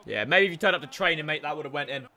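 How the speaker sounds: usual speaking voice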